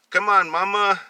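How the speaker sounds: put-on high, loud begging boy's voice; pleading child. Boy begging his mother not to make him go in a dress.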